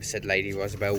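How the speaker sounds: ordinary delivery, nothing marked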